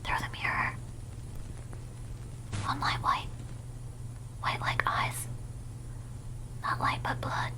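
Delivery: eerie, hushed horror whisper. Acted. Streamer reading scary video text, whispering. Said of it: "The whispering voice goes crazy".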